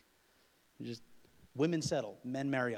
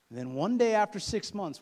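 much louder, higher, launching the turn of the story; loud build-up; 9 semitones higher, 11 dB louder than usual. Acted. Preacher to congregation, loud excited build-up. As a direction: raise the stakes with a big loud push.

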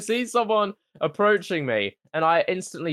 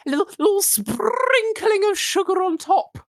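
very high silly voice; tiny high-pitched sing-song; playful squeak. Acted. Mocking singsong of lime remark.

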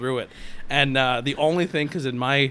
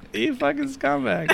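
mock insult; 13 semitones higher, slower than usual. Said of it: "She's like".

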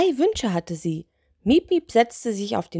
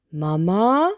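slightly louder, rising question; booming call, only lightly done; deep, loud voice; 4 dB louder than usual. Acted. Lantern spirit waking in a cave, deep loud voice. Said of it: "Eine tiefe, laute Stimme".

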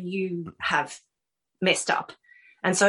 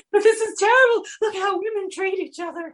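much higher and louder, hammed-up crying protest; playful re-enactment of blubbering at a sad film; mock tearful wail; 12 semitones higher, 3 dB louder than usual. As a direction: imitate herself sobbing in the cinema, pitched up. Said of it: "Bridesmaids made me bawl crying".

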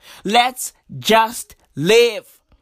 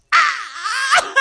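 high squealing laugh of disbelief; cracking up in disbelief. Real, not acted. Exasperated laugh at the numbers.

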